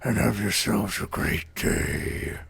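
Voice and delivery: low, slow tree-creature voice; Treebeard. Pretend. Host signs off to viewers as an old tree, deep ponderous voice. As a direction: draw out each word in a deep ancient rumble. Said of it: "Should I do my Treebeard voice now?".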